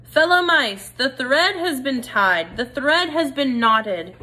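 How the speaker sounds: high, loud call to the crowd, slow and proud; shouted announcement. Acted. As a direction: proclaim it to the whole hall of mice. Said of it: "turned and shouted".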